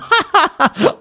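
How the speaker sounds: high gloating cackle over the gold; greedy bandit laugh. A narrator's reading.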